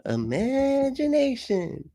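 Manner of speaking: high, long-drawn cartoon voice; slow, high-pitched cartoon impression; SpongeBob. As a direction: stretch it out dreamily up high. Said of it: "she's using SpongeBob".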